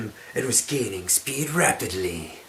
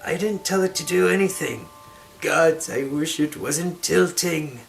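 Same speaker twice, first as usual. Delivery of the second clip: hammy stage actor; 5 semitones higher, 3 dB louder than usual. Acted. Amira flustered on tilting carpet.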